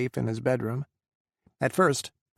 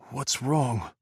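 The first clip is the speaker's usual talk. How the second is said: wavering worry. Acted. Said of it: "The steel in Adam's voice wavered".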